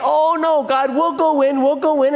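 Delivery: high, pleading imitation of grumblers; mock complaining. Israelites begging God to let them go in, mock complaining. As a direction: protest in an exaggerated high voice. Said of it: "Then they grumble and complain".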